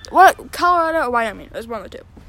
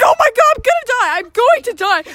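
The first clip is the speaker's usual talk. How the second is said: high, loud fearful wail; dramatized panic; 8 semitones higher, 3 dB louder than usual. Retold or imitated. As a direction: wail as if doomed, hammed up. Fear of dying, retold.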